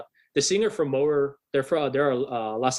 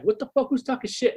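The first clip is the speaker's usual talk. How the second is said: pitched-up mock tough-guy challenge; tough bouncer; 9 semitones higher than usual. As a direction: play the guard spoiling for a fight. Guard's tough reaction, retold.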